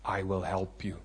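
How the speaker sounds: low, soft, slow voice from above; a gentle divine voice, low and hushed; voice of the Holy Spirit. Retold or imitated. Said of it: "He says to me".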